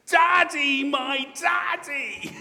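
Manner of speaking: put-on high, loud child's cry of daddy; ringing, stretched-out cry. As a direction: call out high and drawn-out to a long-lost father. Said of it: "comes the cry".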